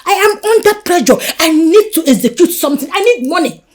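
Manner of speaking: rising from firm to loud and high; building desperate shout about needing money; pressured outburst. Acted. Woman under pressure shouting at Jennifer.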